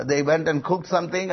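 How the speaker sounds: usual speaking voice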